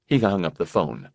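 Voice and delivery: usual speaking voice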